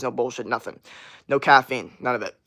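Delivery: usual speaking voice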